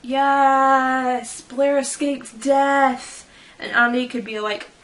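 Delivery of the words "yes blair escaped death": excited cheer. Retold or imitated. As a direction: cheer like a relieved friend. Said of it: "Mikey would be all like".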